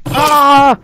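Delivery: high squeaky screaming; high mock screams. Figures scream high as they fall. Characters falling in game.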